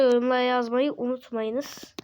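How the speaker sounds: usual speaking voice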